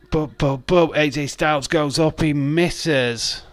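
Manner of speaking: excited commentary. Real, not acted. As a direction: call the move live. Calling wrestling match live.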